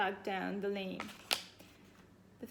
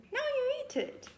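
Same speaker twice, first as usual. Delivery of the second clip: put-on high girl voice; faster than usual.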